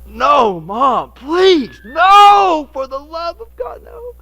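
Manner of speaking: hammed-up kid begging mom for mercy, voice cracking up high; desperate pleading. Boy begging his mother not to make him show the door, hammed-up desperate plea. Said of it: "I went".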